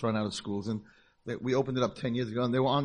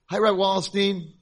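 bright greeting; 8 semitones higher, 6 dB louder than usual. Retold or imitated. Girls greet the rabbi.

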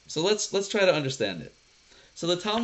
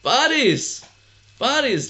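high, loud, triumphant call; excited exclamation; 9 semitones higher, 6 dB louder, slower than usual. Real, not acted. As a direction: burst out with the long-awaited answer. Teacher exclaims the awaited answer.

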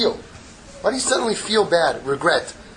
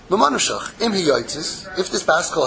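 ordinary talk

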